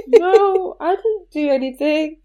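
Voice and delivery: high-pitched fake-innocent child voice; lying little girl. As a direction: squeak out an innocent lie like a small child. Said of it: "And I was like".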